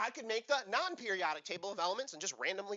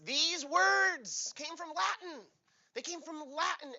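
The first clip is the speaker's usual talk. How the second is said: mock grand announcement; 10 semitones higher, 3 dB louder, slower than usual. Mock grand slow declaration.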